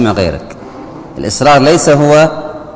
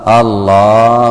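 slow calm drawl; much slower than usual. Shown as a demonstration. Mocking a standing imam who slowly drawls الله. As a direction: draw out الله lazily, in no hurry. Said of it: "بكل هدوء".

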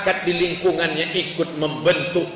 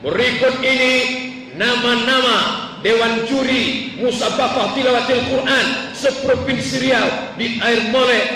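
announcer reading out names; 4 semitones higher than usual. Retold or imitated. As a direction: call the jury to the stage formally.

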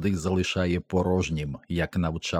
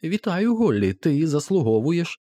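much higher-pitched greeting voice; голос із жарту; 9 semitones higher than usual. A narrator's reading. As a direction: lift the voice high for an unseen well-wisher. Жінка чує голос. (високий голос привітання)

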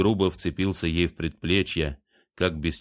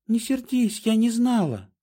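flustered apology. A narrator's reading. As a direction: plead not to be angry. Trian apologising in alarm.

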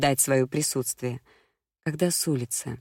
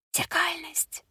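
таинственный шёпот; 14 semitones higher, 5 dB quieter, slower than usual. A narrator's reading. Гадалка выдаёт таинственное слово.

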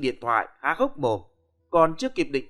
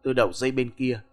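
usual speaking voice

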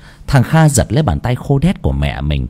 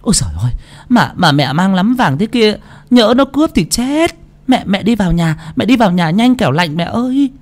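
high, sugary put-on wheedling voice; high fawning coax; fawning daughter-in-law; 10 semitones higher than usual. A narrator's reading. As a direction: coo over the old mother to flatter her. Fawning daughter-in-law greets mother.

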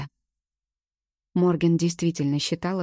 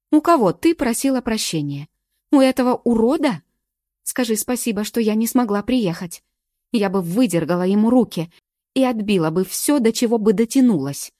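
loud, high, raging threats; furious; 4 semitones higher, 7 dB louder than usual. A narrator's reading. Morgen raging at Galka about the abuser, furious. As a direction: rage at the abuser through her friend. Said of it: "яростно сказала она".

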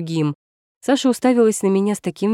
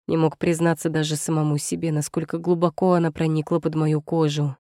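ordinary delivery, nothing marked

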